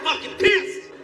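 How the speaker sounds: high-pitched indignant ranting; pissed off. Acted. Comedian insulted as ugly by attendant, high indignant yelling. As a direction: shout back, stung by the insult. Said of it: "Now I'm pissed".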